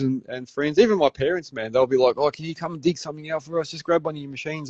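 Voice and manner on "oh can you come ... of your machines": higher, softer mimicked request; casual favour-asking. Parents asking their son to dig something, casual mimicked plea. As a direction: imitate an offhand little ask.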